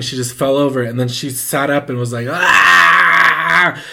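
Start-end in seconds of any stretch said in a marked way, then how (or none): 2.3-3.8 long, loud possessed scream; raised, drawn-out mock shriek; imitated scream. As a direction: scream like jolting back up possessed. Imitates mother screaming as she revives.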